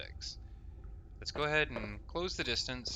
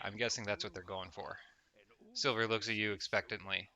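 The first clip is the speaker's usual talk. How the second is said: ordinary delivery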